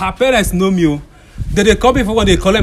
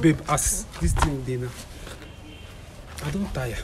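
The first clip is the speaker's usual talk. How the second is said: low, soft admission of exhaustion; weary, subdued; 7 semitones lower, 13 dB quieter than usual. Acted. Boyfriend telling girlfriend he is tired, weary and low. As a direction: drop low and quiet, worn out. Worn-out boyfriend gives up arguing.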